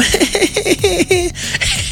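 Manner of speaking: put-on squeaky little-kid giggle; high giggle. Kid laughing at his joke, with a high squeaky giggle. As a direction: giggle like a small child at his own joke.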